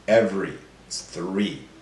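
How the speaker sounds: ordinary talk, nothing marked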